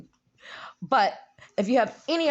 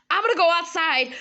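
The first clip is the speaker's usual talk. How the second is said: imitating excited boy; 6 semitones higher than usual. Imitates nephew announcing his plan.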